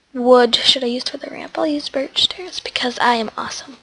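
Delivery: whispered aside to herself. Real, not acted. Choosing stairs for the ramp, whispering to herself. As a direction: whisper it like a private thought. Musing aside while building.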